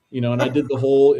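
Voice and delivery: normal talking voice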